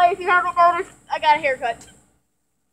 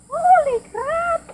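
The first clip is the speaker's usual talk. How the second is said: shocked gasp; 10 semitones higher, slower than usual. Real, not acted. Jumps into freezing water.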